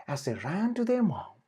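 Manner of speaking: usual speaking voice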